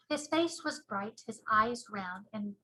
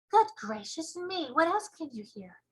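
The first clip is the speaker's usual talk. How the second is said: put-on little girl voice; 6 semitones higher, 3 dB louder than usual. Sophie amazed.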